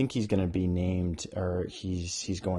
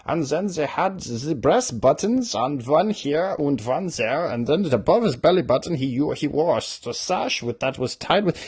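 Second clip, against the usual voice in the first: higher, mocking over-detailed description; mocking fussy author; 7 semitones higher, 6 dB louder than usual. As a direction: parody a fussy author droning on. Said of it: "authors take painstaking time to tell you".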